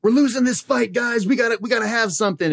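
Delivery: rally the team in a panic; battlefield shout. Pretend.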